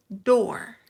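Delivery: door with an English r; English pronunciation. Shown as a demonstration. Teacher shows learners the English word, door clearly pronounced. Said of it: "pero decimos en inglés".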